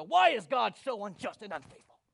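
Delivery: loud, indignant mock tirade; angry outburst. Believer storming off in the other direction, indignant. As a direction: shout the complaint as if storming off. People storm off complaining loudly.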